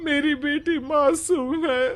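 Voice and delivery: sobbing as he begs the landlord, voice soft and breaking; tearful pleading. Acted. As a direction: plead through tears for his innocent daughter. Father begs landlord for daughter.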